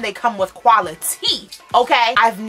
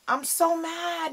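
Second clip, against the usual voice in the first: normal talking voice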